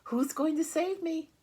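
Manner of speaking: high, loud pleading pig voice. Acted. Wilbur begs Charlotte to save him, high and loud. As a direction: beg for rescue.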